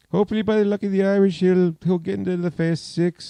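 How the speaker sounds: high, fast, hammed-up Irish leprechaun accent. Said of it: "make him sound like a leprechaun".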